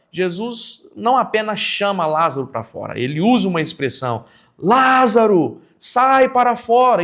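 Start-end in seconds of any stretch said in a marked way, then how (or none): 4.6-7.0 a slow, high summons with full voice; raised commanding call. Shown as a demonstration. Teacher demonstrates Jesus calling Lázaro out of the tomb, raised commanding call. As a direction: call out loudly, high and drawn out. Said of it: "é uma expressão mais elevada, de entonação".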